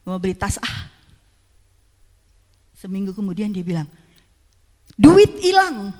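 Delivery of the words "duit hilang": loud, high, shocked outcry; angry outburst. Retold or imitated. Husband discovering the missing money, loud angry outcry. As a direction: blurt it out in anger. Husband finds savings gone.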